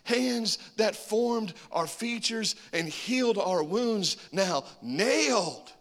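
high, loud, slow preaching; preacher building to the sermon climax, high and slow. Acted.

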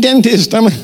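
high-pitched cartoon elf voice; little poser dentist. Mimicking the movie's dentist elf, high put-on voice. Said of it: "his little poser dentist friend".

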